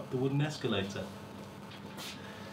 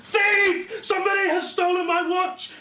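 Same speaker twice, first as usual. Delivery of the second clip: high, loud outraged yell; furious shout; 7 dB louder than usual. Acted. Gentleman yelling to passengers about his stolen watch, outraged shout. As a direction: bellow it for the whole train to hear. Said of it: "he shouted".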